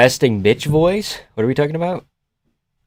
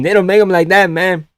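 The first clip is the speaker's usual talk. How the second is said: higher, put-on Mexican accent; 5 semitones higher than usual. Streamer repeating the Uncrustable objection, higher mock Mexican accent.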